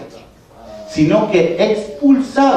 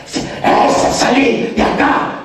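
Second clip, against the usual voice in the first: demon voice; 8 semitones higher, much slower than usual. Retold or imitated. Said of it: "pero un día cuando pasaba".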